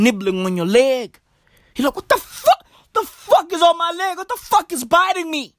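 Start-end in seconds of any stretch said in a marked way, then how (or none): 2.0-5.5 higher-pitched startled disbelief; mock alarm. Someone finds something nibbling their leg, mock alarm. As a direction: yelp it like something just bit you. Acting out victim being bitten.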